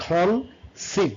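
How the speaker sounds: "কনসিভ" slowly in two parts; split into syllables. Shown as a demonstration. Teacher showing how the word sounds, syllable by syllable. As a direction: pause between কন and সিভ in "কনসিভ". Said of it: "এখানে দুইটা syllable দেয়".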